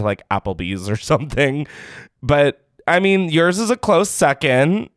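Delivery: usual speaking voice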